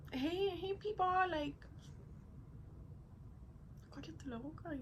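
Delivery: mock hush. Mock telling relatives to shut up.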